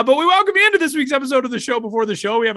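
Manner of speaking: high, fast, loud host read; podcast host intro. Acted. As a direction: announce the episode brightly.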